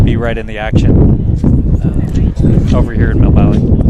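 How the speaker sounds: loud voice pushing over wind; shouting over wind. Real, not acted. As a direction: raise your voice over the gusts. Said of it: "if you can hear me over the wind".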